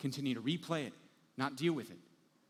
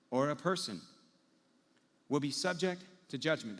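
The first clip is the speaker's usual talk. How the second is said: preaching Jesus' words; 2 semitones higher, 4 dB louder than usual. Acted. Preacher quotes Jesus' sermon.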